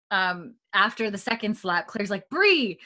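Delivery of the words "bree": sharp rebuke. Retold or imitated. Claire scolding Bree's slap.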